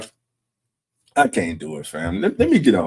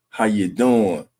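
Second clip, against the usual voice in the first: ordinary talk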